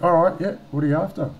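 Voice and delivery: animated mock phone voice; brisk phone reply. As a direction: retell yourself on the phone, loud and high. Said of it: "I'm like".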